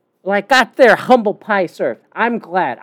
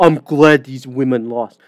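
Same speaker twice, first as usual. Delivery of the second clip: mocking these people; 8 semitones lower than usual. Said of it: "like, these people are".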